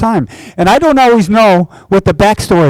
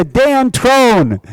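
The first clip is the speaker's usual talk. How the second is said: ordinary talk, nothing marked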